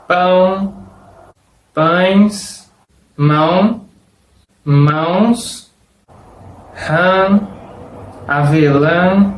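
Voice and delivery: slow word list, nasal endings as in "mansão" and "rã"; each word alone, pauses between, "ão" nasalized; Portuguese nasal examples. Shown as a demonstration. Teacher listing words with virgulilla, slowly and nasalized. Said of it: "Ejemplos de palabras con virgulilla".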